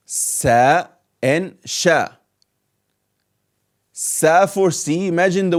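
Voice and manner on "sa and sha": Sa and sha spoken slowly, each syllable drawn; sound it out. Shown as a demonstration. Teacher repeats Sa and sha slowly for the class.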